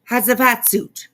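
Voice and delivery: "Has a fat suit" said quick and loud for Hatzifistaktau; pronunciation trick. Shown as a demonstration. Streamer teaches viewers to say the surname as "has a fat suit". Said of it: "You say".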